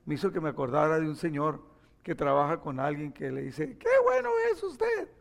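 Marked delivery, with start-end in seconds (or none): 3.8-5.1 very high-pitched cartoon toy; tiny squeaky flatterer; Toy Story toy voice. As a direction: squeak it out like a little toy creature. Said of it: "esos son los de Toy Story".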